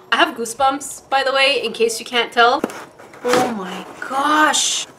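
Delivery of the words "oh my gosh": tender awe. Real, not acted. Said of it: "I am so in love".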